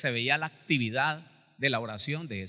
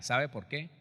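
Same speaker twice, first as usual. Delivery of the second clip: ordinary talk, nothing marked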